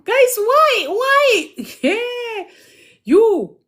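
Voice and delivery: high, loud laughing cry of why; cracking up and shouting at the chat; real laughter breaking through high yelps. Real, not acted.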